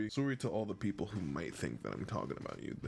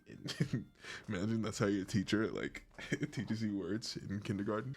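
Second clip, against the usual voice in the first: ordinary delivery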